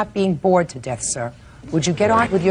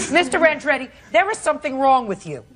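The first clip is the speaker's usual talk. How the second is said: raised, incredulous scolding; exasperated judge; 8 semitones higher than usual. Real, not acted. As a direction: let the disbelief ring out.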